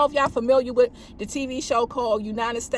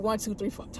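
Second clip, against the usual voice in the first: much softer, lower, swallowed numbers; half-heard radio call; clipped mumble; 4 semitones lower, 9 dB quieter than usual. Retold or imitated. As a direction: mumble it like a cut-off transmission. Caller's words cut off on radio.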